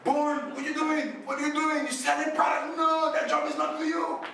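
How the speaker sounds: surprised teacher calling out. Retold or imitated. A teacher spots her old student selling goods, high surprised call. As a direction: call out high like a teacher who can't believe it. Teachers spot him in school.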